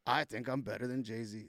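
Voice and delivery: ordinary talk, nothing marked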